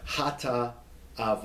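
"Hata Ava" with a little break after "Hata"; "Hata", then "Ava", as two separate pieces; broken in two. Shown as a demonstration. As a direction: pause between "Hata" and "Ava". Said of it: "put a little break there".

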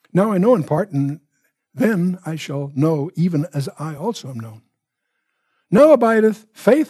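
lifted, measured Bible reading; solemn scripture reading. Acted. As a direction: proclaim the verses like a pulpit reading. Finishes reading the chapter.

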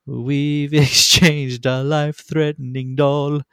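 high, slow mock-earnest recital; silly lyric voice. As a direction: drawl the nonsense line high and slow. Quoting absurd song lyrics.

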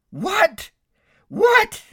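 very high, loud yelp; shocked disbelief. Acted. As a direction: exclaim in comic alarm. Jack shocked at order to let go.

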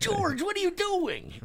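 very high, slow squeaky mock voice; Seinfeld character. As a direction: squeak it out in disbelief. Quoting a Seinfeld scene.

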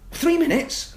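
put-on nagging little kid who won't let it go; high kid voice, mock exasperated; pestering kids.

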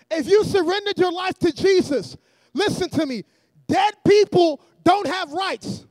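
loud, emphatic sermon delivery; forceful preacher cadence; preaching. Real, not acted. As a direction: preach it to a congregation in measured phrases. Preaches to the congregation.

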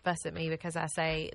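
usual speaking voice

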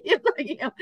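a light imitation of tearful agreement; mock tearful. Telling how she answered the healer, mock tearful. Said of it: "burst into tears".

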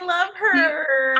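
slow, high, loud cooing; gushing love. Real, not acted. Gushing over Dana, high and drawn out. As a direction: melt over her name, drawn out. Hearing friend Dana's name.

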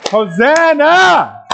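very high, drawn-out cry of praise; loud shout. Shown as a demonstration. Pastor leading congregation's praise, long loud shout. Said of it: "Sing it loud".

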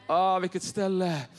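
normal talking voice

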